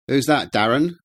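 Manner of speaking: high, loud call from inside; woman calling out. A narrator's reading. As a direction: call to him from another room. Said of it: "came a woman's voice from inside the house".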